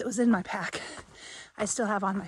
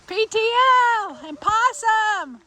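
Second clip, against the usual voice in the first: excited; 11 semitones higher, 8 dB louder than usual. Real, not acted. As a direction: call out their names with joy. Greets the trail angels.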